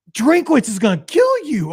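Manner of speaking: high, loud exasperated warning; mock scolding. Dad warning his daughter about the coach, mock scolding. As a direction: warn her in hammed-up outrage.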